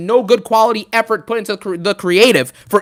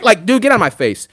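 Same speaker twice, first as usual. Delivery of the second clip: ordinary talk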